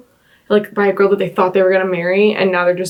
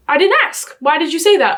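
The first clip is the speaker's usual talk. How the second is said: indignant; 6 semitones higher than usual. Real, not acted. Reacting to crude message.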